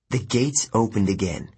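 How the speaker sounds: ordinary delivery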